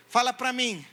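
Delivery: ordinary talk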